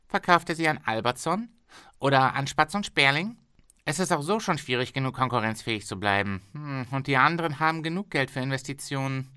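a pushy boss bargaining hard; haggling factory owner. Acted.

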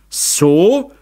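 loud, high, challenging cry; shouted. A narrator's reading. Father shouts into the corner of the room, challenging. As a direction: shout one sharp question. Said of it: "schrie Meister Zauner".

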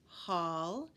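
ordinary delivery

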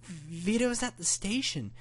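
high-pitched grandma, building to panic; panicking. A narrator's reading. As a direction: let the panic climb, voice very high. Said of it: "Her voice went into a panic".

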